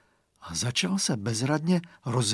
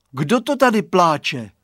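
calling out; 5 semitones higher, 7 dB louder than usual. A narrator's reading. Boy calling into fog.

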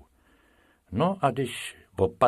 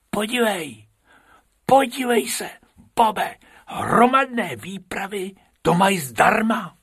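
very high, excited character voice; squeaky, eager exclamation; excited discovery; 11 semitones higher, 4 dB louder than usual. A narrator's reading. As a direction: call a friend to look, pitched way up. Bobek excitedly points out free entry.

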